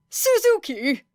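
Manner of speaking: called out high across the room; excited call across a classroom. Acted. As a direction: shout her name to get attention.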